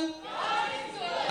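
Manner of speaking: breathy, slow, drawn-out American response; a soft American-accented response. Acted. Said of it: "I went American just then".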